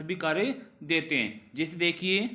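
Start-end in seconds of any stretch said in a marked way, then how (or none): none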